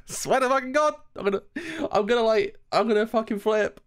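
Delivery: very high, worked-up voice; half-laughing panic at a high pitch; agitated. Real, not acted. Streamer fearing a favourite character dies, worked up.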